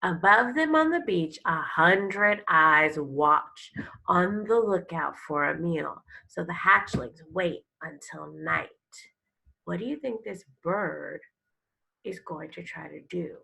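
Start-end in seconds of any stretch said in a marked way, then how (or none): 0.0-8.7 louder, expressive reading of a turtle picture book; storybook read-aloud. Acted. Teacher reading a turtle book to her class, expressive storytelling. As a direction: read it like a nature story for kids.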